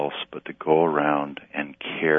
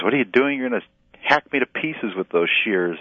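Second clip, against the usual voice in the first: higher, louder cry of alarm from the vine; 6 semitones higher, 3 dB louder than usual. Acted. Vine in the parable crying out at the gardener, alarmed. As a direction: protest in a panic at the pruning shears. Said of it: "and the vine screams".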